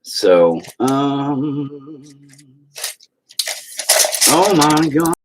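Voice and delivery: ordinary delivery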